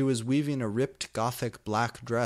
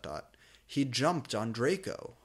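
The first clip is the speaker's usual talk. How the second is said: usual speaking voice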